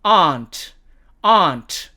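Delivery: British "aunt" with long ah; British demo of "aunt"; British accent. Shown as a demonstration. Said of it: "영국식 발음으로는".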